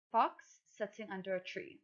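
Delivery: ordinary talk, nothing marked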